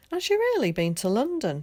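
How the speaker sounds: high, wondering question; wife at the window, asking in awe. A narrator's reading. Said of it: "she asked in awe".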